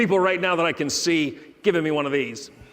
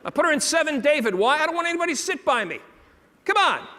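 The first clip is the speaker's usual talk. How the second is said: gleeful; 9 semitones higher, 3 dB louder than usual. Real, not acted. Gleeful confession to audience.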